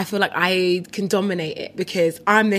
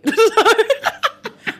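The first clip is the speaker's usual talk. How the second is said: loud high burst of laughter; 4 dB louder than usual. Real, not acted. Loud laugh after her joke.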